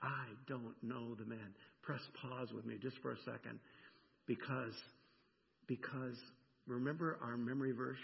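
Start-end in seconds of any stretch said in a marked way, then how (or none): 0.0-1.6 low, hushed, deliberate "I don't know the man"; understated emphatic denial. Retold or imitated. Preacher quoting Peter's last denial, quietly emphatic. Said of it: "and says emphatically".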